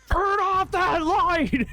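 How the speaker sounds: hoarse angry yell. Retold or imitated. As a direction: bellow it like an old cartoon grump. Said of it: "hoarse, angry, like, deep male voice".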